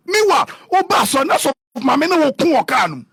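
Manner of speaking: high, loud exclamation in an acted retelling; mock outburst. Acting out what was heard in the car, high mock outburst.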